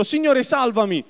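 high, desperate cry for rescue; cry for help. Acted. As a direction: call out as if sinking. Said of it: "gridò".

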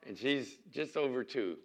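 ordinary delivery

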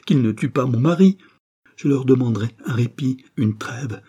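impassioned, high-pitched entreaty; desperate pleading. Acted. Mother begging a reprieve for her dying husband, desperate pleading. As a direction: beg them urgently, voice surging up.

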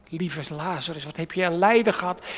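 normal talking voice

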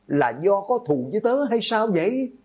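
high-pitched accusing question; angry scolding. A narrator's reading. Doomed student accusing classmates of a grudge, angry scolding. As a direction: berate them, voice pitched high. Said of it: "Hạng Văn Thành đang mắng nhiếc".

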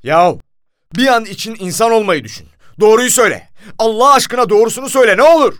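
high, very loud pleading outburst; exasperated yelling, building to a cry; desperate angry shout. A narrator's reading. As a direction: beg at the top of your voice. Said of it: "Elimi masaya vurdum".

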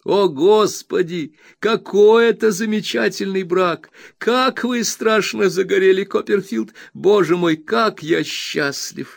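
high, bright, eager friend's voice; excited Traddles. A narrator's reading. Traddles reassuring his dear Copperfield, high and overjoyed. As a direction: bubble over with glad welcome. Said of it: "воскликнул Трэддлс".

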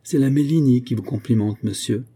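ordinary delivery, nothing marked

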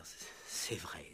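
whispered, slow and sheepish; embarrassed whispered admission. Acted. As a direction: mumble it under your breath, caught out. Sheepish inspector mumbles.

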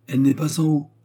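a touch of a high, loud sneer; lightly mocking old concierge. A narrator's reading. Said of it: "ricana la veuve Pipard".

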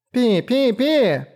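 high tiny chick peeps; peeping baby chick. Acted. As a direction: peep like tiny birds. Chicks peep on shoulders. (писк птенцов)

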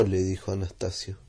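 usual speaking voice

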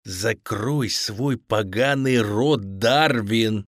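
slow, menacing order; spiteful. A narrator's reading. Captor silencing the bound boy, spiteful menace. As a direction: drag it out with malice. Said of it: "злобно произнёс Петров".

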